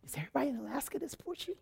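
soft, fast stunned muttering; stunned aside. Retold or imitated. Wife privately stunned by the pushy motel lady, softly. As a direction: mutter it to yourself under your breath. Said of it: "I was like".

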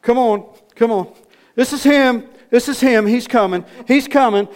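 high, loud, urgent announcing; excited calling out. Acted. Man rushes the praying preacher into the hospital, excited shouting. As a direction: call it down the hall, pitched right up.

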